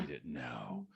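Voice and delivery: very soft, urge-driven murmur; whispered craving. Retold or imitated. Speaker reliving her urge on seeing whiskey, hushed. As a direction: say it under your breath, craving.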